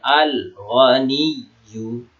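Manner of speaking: slow "Al-Ghaniyyu", l sounded before gh; clearly read lam. Shown as a demonstration. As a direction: recite "Al-Ghaniyyu" drawn out, each letter distinct. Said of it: "Alif lamnya dibaca aja, jelas".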